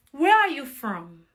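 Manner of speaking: normal talking voice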